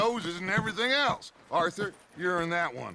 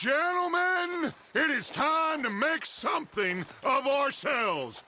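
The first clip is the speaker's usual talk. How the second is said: rallying shout; 6 semitones higher than usual. Acted. Rallying the gang for robbery.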